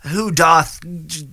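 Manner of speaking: a hint of mock Shakespearean declaiming. Mocking Shakespearean actors in Marvel films, lightly theatrical. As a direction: lightly ham up an old stage voice. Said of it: "it's very".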